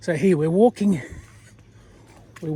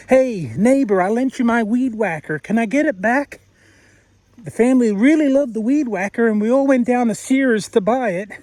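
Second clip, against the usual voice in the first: higher-pitched put-on American neighbor; American accent; 4 semitones higher than usual. Neighbor asking for the weed whacker back, mock American accent. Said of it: "but Americans call".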